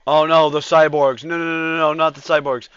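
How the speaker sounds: loud panicked cries; panicked yelling. Real, not acted. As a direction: yell it in panic. Cyborgs attack his units.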